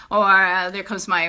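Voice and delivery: ordinary delivery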